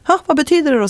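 high, intrigued question; curious astonished question. Retold or imitated. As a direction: lean in wanting to know. Man asks her meaning.

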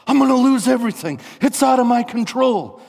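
high, loud desperate yell; acted panic. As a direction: cry out in hammed-up despair. Acting the voice of despair.

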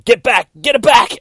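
very high, loud screaming; terrified shout. Acted. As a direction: shriek it in panic. Doctor pushed toward the pit.

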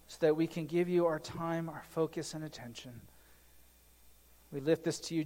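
soft, reverent prayer voice that fades toward the amen; hushed pastor's prayer; opening prayer. Acted. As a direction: pray quietly before the sermon.

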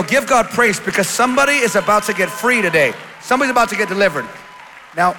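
very loud, rousing preacher; full-force preaching. Real, not acted. Preacher urging the church to praise God, full-force shouting. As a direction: belt it out to rally the congregation.

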